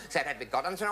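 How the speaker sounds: ordinary talk, nothing marked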